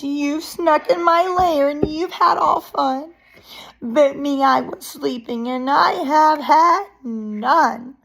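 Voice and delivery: high, loud storybook whine; whimpering bear. A narrator's reading. Bear complaining to uninvited friends, hammed-up whimper. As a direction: wail it like a left-out bear. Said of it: "he whimpers and he moans".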